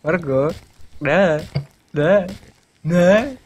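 escalating frantic protest; loud pleading no. Real, not acted. Begging friend not to throw.

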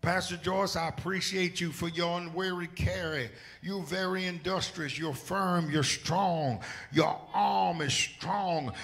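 loud, high preaching cry. Real, not acted. Pastor praising Pastor Joyce, loud preaching. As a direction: preach it out with full force.

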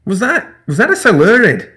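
high, loud stammering shock; stuttering. Acted. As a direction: stumble over the words in alarm. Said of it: "Professor Forbes stuttered".

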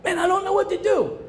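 high, whiny mock confusion; squeaky put-on voice of someone confused; confused whiner. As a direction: mimic a lost, confused person. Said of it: "I'm not the only one that said that".

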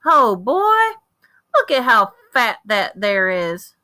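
high, loud country holler; country boy hollering. Acted. Boy hollers at a fat squirrel across the street, loud and high. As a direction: call it out to the tree, delighted. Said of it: "and hollered".

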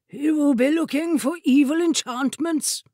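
high-pitched, haughty wizard voice; lofty rival wizard. A narrator's reading. Rival wizard explaining to the court ladies, loftily. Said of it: "said the rival wizard loftily".

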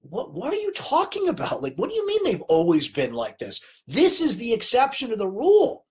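exasperated, high-pitched disbelief; frustrated. Real, not acted. Host talking back to Charles Barkley on TV, frustrated. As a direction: talk back in incredulous protest. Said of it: "I'm getting frustrated with".

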